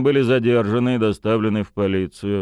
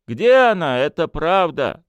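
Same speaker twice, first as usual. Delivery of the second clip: bitter; 9 semitones higher, 5 dB louder than usual. Acted. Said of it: "горько произнёс Петрович".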